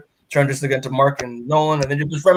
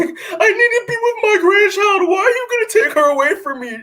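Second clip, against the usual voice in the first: high, loud, whiny plea with a laugh; hammed-up desperate grandma voice; mock pleading mom; 3 dB louder than usual. Recapping the comic, voicing the mom begging to keep her grandchild, high mock whine.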